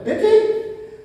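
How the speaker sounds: high, rising questioning admission; challenging confession. Acted. Saul asking the prophet if this satisfies him, challengingly. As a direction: toss it up like an insincere question. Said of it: "é isso que tu quer ouvir".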